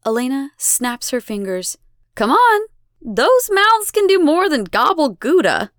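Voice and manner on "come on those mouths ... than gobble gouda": high, loud, impatient prodding; impatient goading. A narrator's reading. Elena snaps her fingers at the children, goading loudly. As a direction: goad them at raised voice. Goddess hurries silent captives.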